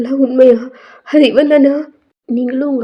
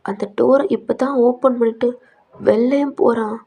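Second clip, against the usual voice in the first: ordinary delivery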